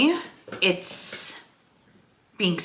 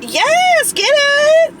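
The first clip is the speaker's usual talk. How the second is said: very high, louder delighted yelp; squealing cheer from the passenger seat; excited cheer; 3 dB louder, much slower than usual. Real, not acted. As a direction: cheer like she just nailed it. Cheering Ava's parking.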